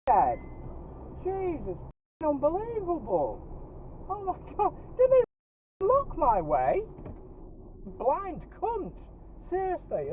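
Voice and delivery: high-pitched furious swearing; swearing tirade. Real, not acted. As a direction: rant high and strained at the driver. Driver cut off, high-pitched swearing.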